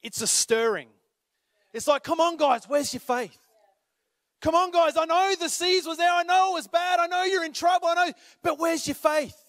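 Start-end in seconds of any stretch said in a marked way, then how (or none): 2.0-9.3 rally them like a coach calling out; Jesus urging disciples. Pretend.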